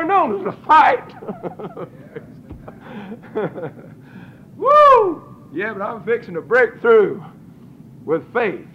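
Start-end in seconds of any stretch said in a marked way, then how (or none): 4.6-5.2 high, loud whoop with a laugh; joyful whoop. Real, not acted. Preacher whooping for joy at the congregation. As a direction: let out a happy holler.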